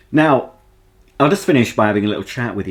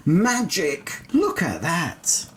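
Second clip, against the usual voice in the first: mock amazement; 6 semitones higher, 5 dB quieter, slower than usual. Showing off the coffee maker.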